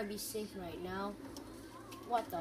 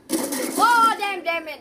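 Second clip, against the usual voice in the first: loud high damn it; frustrated outburst; 7 semitones higher, 11 dB louder, slower than usual. Real, not acted. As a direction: curse out in frustration.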